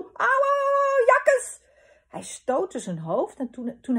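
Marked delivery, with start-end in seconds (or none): 0.0-1.7 very high, loud howls of ouch; acted yelps of pain. A narrator's reading. Papa hitting his head on the plane, hammed-up yelps. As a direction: yowl as if you just banged your head.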